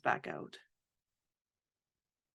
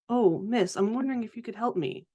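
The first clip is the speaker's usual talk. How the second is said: higher-pitched, quick polite ask; lightly acted, lilting request; playful polite request; 8 semitones higher than usual. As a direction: ask the child for help, pitched up. Greeting a child in character.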